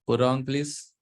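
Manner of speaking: normal talking voice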